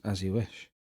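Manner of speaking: soft, low, humble answer; quiet devoted reply. Retold or imitated. Stable boy answering the princess, quiet and humble. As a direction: say it softly, like a secret confession.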